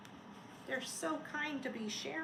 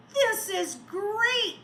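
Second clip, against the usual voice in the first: loud, slow, high joyful cry; delighted cry; 7 semitones higher, 8 dB louder, much slower than usual. Acted. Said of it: "the snowman cried".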